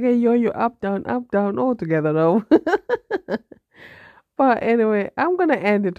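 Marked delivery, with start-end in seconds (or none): 2.5-3.5 short high-pitched laugh. Real, not acted. Laughs at her own joke.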